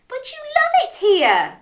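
high, pleading exclamation; crying out. Acted. Crab begs Dog to stay, crying out. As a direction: protest with pitch shooting up. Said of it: "cried Crab".

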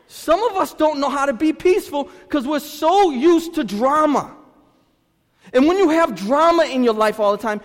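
loud, high preaching to the church; full force preaching. Acted. Pastor preaching hard to his congregation about drama.

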